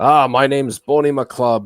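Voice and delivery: put-on loud ogre with a thick Scottish accent; big, booming Scottish burr; hard Scottish accent. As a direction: announce yourself boldly as a Scottish ogre. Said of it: "hard with the Scottish accent".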